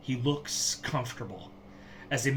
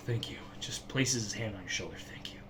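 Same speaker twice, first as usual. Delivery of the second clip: soft thanks; 4 semitones lower, 8 dB quieter than usual. Acted. Blair's quiet gratitude.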